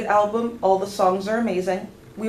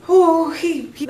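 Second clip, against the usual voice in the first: overwhelmed; 8 semitones higher, 6 dB louder, slower than usual. Real, not acted. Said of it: "that killed me".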